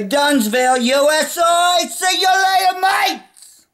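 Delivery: sign-off shouted in a put-on English accent. Shown as a demonstration. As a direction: yell it out in a hammed-up English accent. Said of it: "I always, uh, say".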